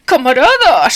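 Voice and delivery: high, loud pleading call; pleading cry. A narrator's reading. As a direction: beg the comrades. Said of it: "suplicó". (súplica en voz alta)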